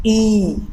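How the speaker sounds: ई as a long, close front vowel with spread lips; vowel demo. Shown as a demonstration. Teacher showing students the ई vowel, clear and held. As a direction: say ई clearly and hold it, tongue forward. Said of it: "इस vowel symbol को pronounce करने के लिए ई".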